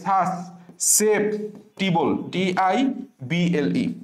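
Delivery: very slow, each part spaced out; slow dictation. Shown as a demonstration. Teacher slowly dictating the spelling for students to memorise. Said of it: "একটা টানা মুখস্থ রাখতে হবে".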